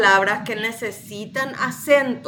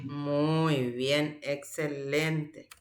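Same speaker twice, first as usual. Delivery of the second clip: elogio suave; 5 semitones lower, 9 dB quieter, much slower than usual. Real, not acted. Teacher praises student.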